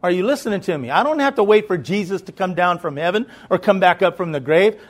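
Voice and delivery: ordinary delivery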